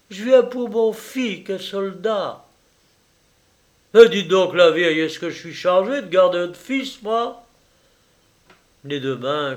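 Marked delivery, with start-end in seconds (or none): 0.1-2.4 peasant mother. Acted. Timid peasant mother asks. 3.9-7.4 louder, higher brusque retort; rude scolding. Acted. Assistant surgeon snapping at the old peasant woman, rude scolding.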